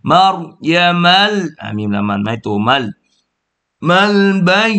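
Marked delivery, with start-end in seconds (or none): 3.9-4.7 มัลบัย with doubled ย held a little; shadda demo. Shown as a demonstration. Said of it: "บนยามีตัชดีดอยู่นิดนะครับ". (สาธิต มัลบัย ย้ำตัชดีด)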